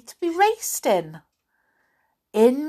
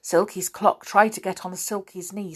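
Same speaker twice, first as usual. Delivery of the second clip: plain reading voice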